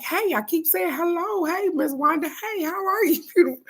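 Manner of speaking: high mock greeting. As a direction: pitch it way up, teasing the friend. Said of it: "and I was like".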